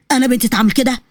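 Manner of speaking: ordinary delivery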